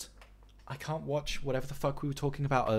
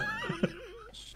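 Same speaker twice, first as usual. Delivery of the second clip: high laugh; 11 dB quieter than usual. Real, not acted. Laughs at bad plot.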